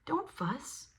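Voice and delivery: high squeaky little mouse voice; lightly voiced reassuring mouse. A narrator's reading. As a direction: cheer him up like a tiny mouse. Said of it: "Mouse comes in and squeaks".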